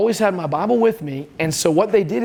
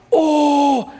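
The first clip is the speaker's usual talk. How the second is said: put-on much higher, thrilled teenage cheer; excited kids; 8 semitones higher, much slower than usual. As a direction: sell the kids' delight, pitched way up. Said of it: "They're excited".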